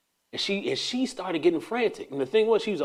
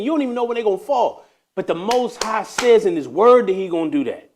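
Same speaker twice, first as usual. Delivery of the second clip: rising, louder preacher cadence; preaching; 5 semitones higher, 5 dB louder than usual. Acted. Preaching to himself about obedience, rising fervor.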